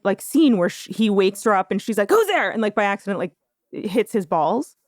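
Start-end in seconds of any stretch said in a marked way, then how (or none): 2.1-2.5 high-pitched startled wake-up cry; quick high yelp in mock alarm. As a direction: imitate someone jolted awake, pitched way up. Retold startled movie character waking up.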